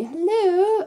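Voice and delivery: high, loud sing-song greeting; hammed-up squeaky hello; mock girly hello. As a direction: chirp it like a cartoon girl. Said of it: "They don't really talk like that".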